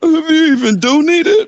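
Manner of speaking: high, slow whiny mimicry of his question; mocking whine. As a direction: parrot him back in a crybaby voice. Mocks partner's question back at him.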